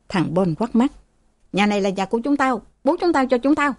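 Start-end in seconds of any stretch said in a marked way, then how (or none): 1.5-3.7 fast, rising angry claim. Acted. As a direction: insist it's ours, voice climbing. Brother argues ownership angrily.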